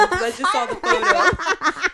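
loud laughing through the words; can't stop laughing at it. Real, not acted. Laughing at the photo.

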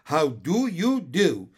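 booming, slow hearty hello; hearty greeting. Acted. Uncle welcoming Michael, lightly voiced hearty greeting. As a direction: greet him like a jolly uncle. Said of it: "said Mister Wig heartily".

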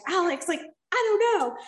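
high, helpless mimic of mom; mom's helpless reply. Mom answering her upset daughter, high helpless mimic. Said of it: "And she's like".

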